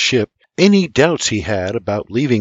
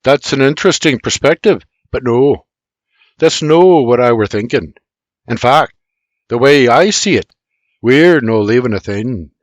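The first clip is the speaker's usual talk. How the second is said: louder Irish uncle, calm and sure; Irish man voice; 6 dB louder than usual. Acted. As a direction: speak as a steady Irish uncle. James Junior character voice, Irish dialect.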